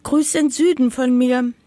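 high, loud farewell call to the birds; calling out goodbye. Acted. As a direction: call after the flying swallows. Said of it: "rief das Häckslein".

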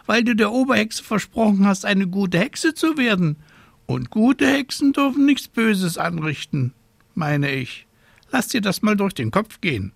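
high, fussy scolding raven voice. A narrator's reading. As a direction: play a worried, scolding raven. Raven admonishes.

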